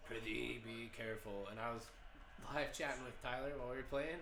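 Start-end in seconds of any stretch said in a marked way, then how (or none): none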